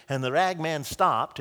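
normal talking voice

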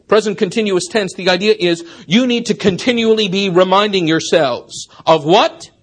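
ordinary talk, nothing marked

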